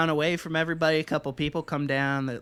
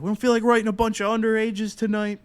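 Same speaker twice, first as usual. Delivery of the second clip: imitated cop laying down the rules, higher-pitched; police officer; 6 semitones higher, 3 dB louder than usual. Lead officer to underage partygoers, an imitated police announcement. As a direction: announce it like an officer addressing a crowd. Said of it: "lead officer or whatever it was".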